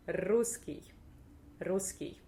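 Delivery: "русский" with a heavily rolled first r; exaggerated rolled r. Shown as a demonstration. Russian teacher showing learners the word, exaggerated rolled r. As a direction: trill the r at the start of "русский". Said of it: "roll it that much like I do right now".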